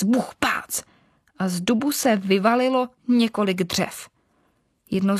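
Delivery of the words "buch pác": whispered knock sound, pitched up; hushed knocking again, a little higher; whispered bang, rising. A narrator's reading. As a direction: echo the knocks in a whisper with a lift. Noises inside the oak.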